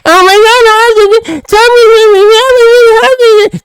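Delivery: mocking crybaby voice. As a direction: parody a sobbing snowflake.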